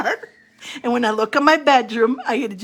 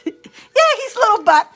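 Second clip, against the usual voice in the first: laughing; 11 semitones higher, 5 dB louder than usual. Real, not acted.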